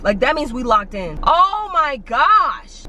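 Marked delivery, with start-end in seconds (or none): 1.2-2.8 exasperated. Real, not acted. Exasperated end of rant.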